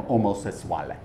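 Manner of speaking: omosessuale said the Italian way, oh-mo-ses-SWAH-leh; Italian vowels in omosessuale; Italian pronunciation. Shown as a demonstration. Comedian says omosessuale in Italian pronunciation. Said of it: "as it's pronounced in Italian".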